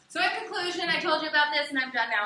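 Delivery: loud, high mock conclusion; hammed-up wrap-up, starting high and loud; hurried-to-finish student speaker. Teacher imitating students eager to end a speech, loud mock conclusion. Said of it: "People love to do that too".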